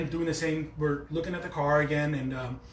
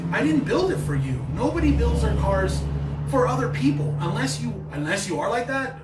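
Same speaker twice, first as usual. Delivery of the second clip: louder and higher, heated venting; frustrated rant; 7 semitones higher, 6 dB louder than usual. Real, not acted. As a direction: really let it out, fed up with judgers. Ranting about people judging his car.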